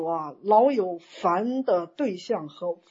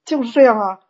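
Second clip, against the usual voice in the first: usual speaking voice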